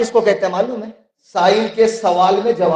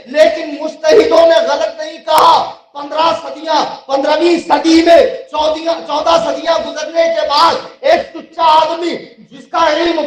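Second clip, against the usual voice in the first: fiery preaching; 6 semitones higher than usual. Real, not acted. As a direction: hammer each point at the rivals, ringing out. Preaches at full force. (जोशीली, ललकारती तक़रीर)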